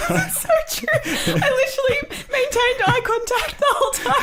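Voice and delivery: very high, squeaky laughing speech; real laughing. Real, not acted. Girlfriend admitting she kept eye contact, high helpless laughter. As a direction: squeeze the words out through giggles. Cracking up at his story.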